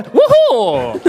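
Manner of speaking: loud, drawn-out woohoo; long, hammed-up cheer; playful big cheer. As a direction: stretch the whoop out and belt it. Host repeats cheer, bigger.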